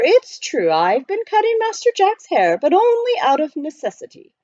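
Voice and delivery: high, precise butler voice; proper butler. Acted. Butler explaining to the miner, politely proper. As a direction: speak like a correct English butler.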